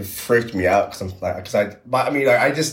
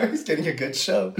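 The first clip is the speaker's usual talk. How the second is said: high, giggling repeat of the joke; laughing; 12 semitones higher than usual. Real, not acted. Joking about the spirit watching him, laughing. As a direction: say it while cracking up.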